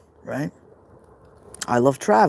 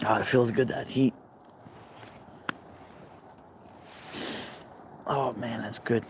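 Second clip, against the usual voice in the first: contented murmur; 2 semitones lower, 10 dB quieter than usual. Real, not acted. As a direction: quietly savour a soothing heat. Savouring the heat, quietly.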